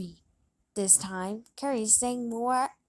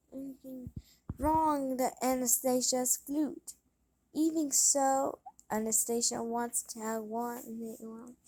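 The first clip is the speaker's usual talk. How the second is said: ordinary delivery, nothing marked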